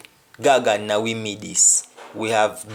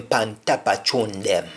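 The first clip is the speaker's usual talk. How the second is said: normal talking voice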